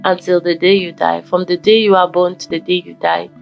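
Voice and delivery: ordinary talk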